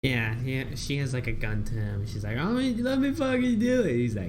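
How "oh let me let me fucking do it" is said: high, fast mock plea; imitated pleading. Selena begging Batman to let her shoot, high mock plea. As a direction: plead in a raised, hurried voice.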